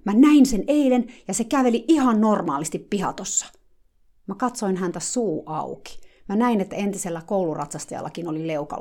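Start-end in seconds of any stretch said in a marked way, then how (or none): 0.1-3.5 high, loud insistence; heated exclaiming. Retold or imitated. Eager helper insisting she saw the horse walk, loud. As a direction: keep shouting your proof.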